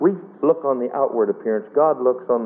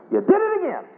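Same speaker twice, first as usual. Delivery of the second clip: high mock wife's voice, much higher; nagging wife; faster than usual. Husband mimics his wife's accusation in a high mock voice. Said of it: "When she gets to the end".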